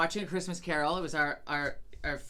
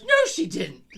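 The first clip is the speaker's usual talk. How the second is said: mock incredulous husband; 9 semitones higher, 8 dB louder than usual. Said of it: "He goes".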